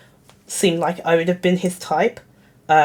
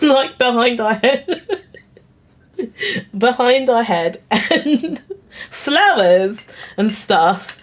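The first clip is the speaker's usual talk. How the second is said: struggling to talk through giggles; laughing; 3 semitones higher, slower than usual. Real, not acted. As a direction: let laughter break up the words. Breaking into laughter while describing picture.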